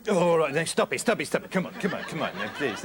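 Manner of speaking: pestered host interrupting; exasperated. Acted. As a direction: cut in fed up, then wearily beg. Host loses patience with guest.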